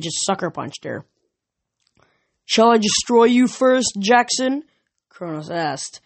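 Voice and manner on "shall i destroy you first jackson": higher, louder, taunting threat; menacing titan. Acted. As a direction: taunt the hero before striking. Kronos threatens Percy.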